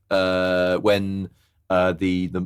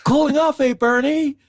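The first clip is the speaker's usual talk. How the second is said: calling out; 5 dB louder than usual. Retold or imitated. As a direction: imitate boaters hailing a friend across water. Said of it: "you just hear people calling out".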